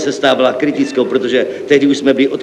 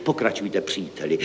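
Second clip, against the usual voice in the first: soft, low invitation; hopeful encouragement; 4 semitones lower, 11 dB quieter than usual. A narrator's reading. Director invites the clerk to continue, gently encouraging. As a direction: urge him on very softly, dropping low. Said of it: "který ve mně skládal velké naděje".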